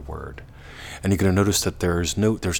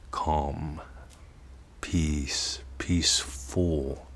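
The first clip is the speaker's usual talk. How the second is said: softer, fading into a whisper; soft calm demo; 2 semitones lower, 5 dB quieter, much slower than usual. Shown as a demonstration. Teacher showing calm words, soft fading to whisper. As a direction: let each word settle inward. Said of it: "Notice the feeling".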